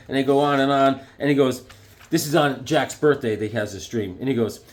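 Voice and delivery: ordinary delivery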